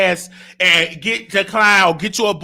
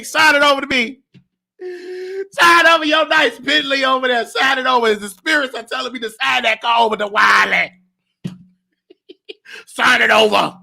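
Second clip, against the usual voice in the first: hammed-up scam psychic, laughing; greedy fortune teller coaxing the client; psychic hotline. Psychic act demanding money.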